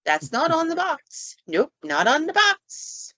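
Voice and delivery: high-pitched acted protest; imitating a spooked toy owner; mock unnerved reaction. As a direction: pitch it up, stretching the last words. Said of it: "And you're like".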